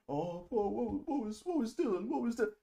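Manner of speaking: high, put-on mocking voice; mock whiny reaction. Streamer mocking a startled guy, high put-on voice. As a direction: squeak it out like a startled whiner.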